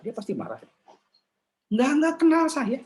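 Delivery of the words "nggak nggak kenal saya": much higher indignant protest; offended reply. Acted. Criticised woman snapping back at stranger, high indignant protest.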